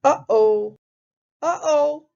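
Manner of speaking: loud uh-ohs stepping up in pitch; mock alarm. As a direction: build the alarm on each uh-oh. Pigs' airplane about to crash.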